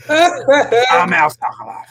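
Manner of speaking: slow, high, hammed-up Boston accent; terrible Boston ad-read voice, drawn out; worst Boston accent. Host mimicking Al from the back office, bad Boston accent. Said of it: "who has the worst Boston accent in the world".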